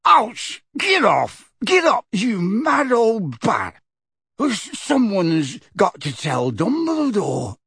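a touch of a cowering crook getting hit; yelping in pain. Acted. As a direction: protest while being beaten with a bag. Being whacked with bag.

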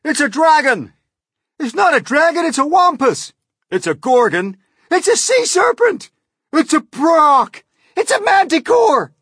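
very high, loud panicky cries; frantic crowd outcry at a monster; alarmed shouting. A narrator's reading. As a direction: yell each guess over the others. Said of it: "all started shouting at once".

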